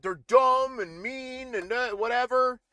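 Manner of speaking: high, slow whiny mock voice; mocking Tucker Carlson. Streamer mocking Tucker Carlson, whiny and high. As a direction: drag it out like a bewildered pundit. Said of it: "what did he say about it".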